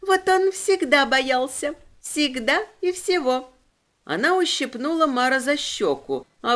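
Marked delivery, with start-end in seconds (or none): 0.0-3.5 put-on high, thin, ringing little girl voice. A narrator's reading. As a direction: go thin and bright like a small girl. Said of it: "Голос демона сделался тоненьким и звонким".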